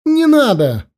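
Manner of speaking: high cry of sudden horror; terrified cry. A narrator's reading. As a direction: cry out in panic, pitch leaping up. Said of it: "ощутив приступ внезапного ужаса". (крик внезапного ужаса)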